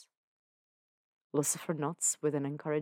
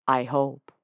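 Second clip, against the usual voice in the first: ordinary delivery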